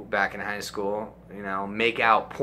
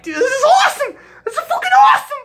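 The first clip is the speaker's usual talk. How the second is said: much higher, much louder laughing yell; ecstatic shout; 11 dB louder, faster than usual. Retold or imitated. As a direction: scream it with giddy delight. Ecstatic during the blowjob.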